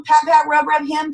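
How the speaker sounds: usual speaking voice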